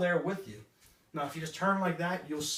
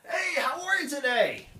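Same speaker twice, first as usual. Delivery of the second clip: loud, high, drawn-out salesman greeting; 10 semitones higher, 6 dB louder, slower than usual. Acted. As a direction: overdo it like a pushy salesperson. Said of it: "think about that stereotypical salesperson".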